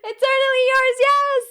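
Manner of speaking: high, loud cry of joy; excited shout. Real, not acted. Streamer shouting with joy at chat's correct guess. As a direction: yell it out, thrilled.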